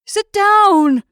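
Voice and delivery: very loud, high bellow; final roar. A narrator's reading. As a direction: roar it with everything left. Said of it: "in a final roar".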